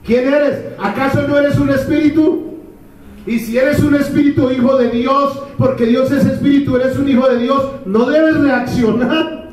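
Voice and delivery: high, insistent pulpit voice; preaching at full force. Acted. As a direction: drive it home to the hearers. Preaching at the congregation.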